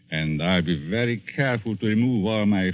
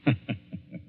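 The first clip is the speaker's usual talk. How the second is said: sinister chuckle; 6 dB quieter than usual. Acted. Villain laughs at his threat.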